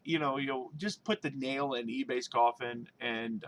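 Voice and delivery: ordinary talk, nothing marked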